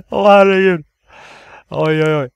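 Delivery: loud, high exclamation after a scare; shaken by fright. Real, not acted. Player recovering from a jump scare, shaken exclamation.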